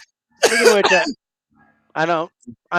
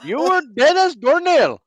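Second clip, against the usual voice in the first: put-on Indian accent; 9 semitones higher, 6 dB louder than usual. Continues the accent bit.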